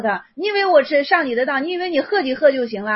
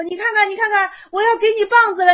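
higher and louder, urging the monk; animated scolding; 8 semitones higher, 6 dB louder than usual. Retold or imitated. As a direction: push it up like a master scolding a disciple. 老师讲公案，替三圣说话. (激动地高声呵斥)

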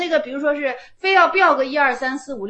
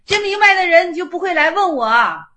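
louder, higher pointed retort; forceful retort; 5 semitones higher, 10 dB louder than usual. Real, not acted. Teacher telling students who truly understand would not ask, loud and pointed. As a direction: snap the conclusion out.

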